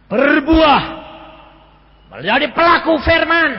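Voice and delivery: loud, high preacher's cry; shouted exhortation. Retold or imitated. Pastor telling the husband what to do, shouted exhortation. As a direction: hammer the answer out at full voice.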